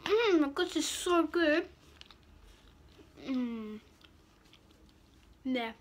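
mumbling through closed lips; savouring food while talking; chewing with mouth full. Real, not acted. Seen 1: Bites bar at -3, lips closed chewing throughout, holds bar.

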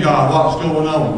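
ordinary talk

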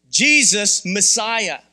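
"Jesus Messiah" stretched out, very slow; slow, weighty repeat. Acted. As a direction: drag each word out for weight. Repeats proclamation much slower.

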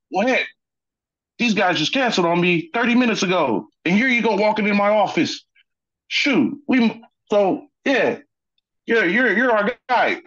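lightly imitating the coach's excited pitch; retelling the coach's lucky break, amused. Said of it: "sports coaching voice".

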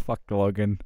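usual speaking voice

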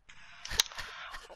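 brief wordless laughter. Real, not acted. Player after a crude joke, laughing.

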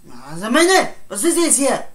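high and loud challenge; angry shout. Acted. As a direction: confront him loudly. Heated argument. (laut herausfordernd)

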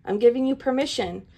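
ordinary delivery

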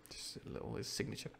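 usual speaking voice

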